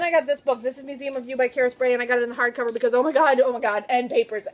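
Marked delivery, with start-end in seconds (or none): none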